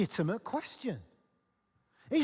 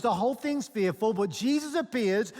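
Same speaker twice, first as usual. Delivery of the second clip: normal talking voice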